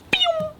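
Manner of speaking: sharp high rising whoosh; quick high pew sound; rocket noise. Retold or imitated. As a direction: zip it up like a rocket taking off. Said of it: "my heart rate just went, like".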